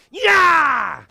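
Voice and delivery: long loud yell, pitch sliding down; shouted yeah. Shown as a demonstration. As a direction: belt it out as a karate yell. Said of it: "my turn".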